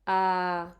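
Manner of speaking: "Ah" held level, mouth wide open; doctor's open wide ah. Shown as a demonstration. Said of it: "when the doctor tells you to open wide".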